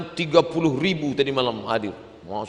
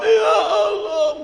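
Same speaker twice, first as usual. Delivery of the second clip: high, drawn-out mock wail; tearful high-pitched cry; imitated weeping; slower than usual. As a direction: cry out like an overcome pilgrim.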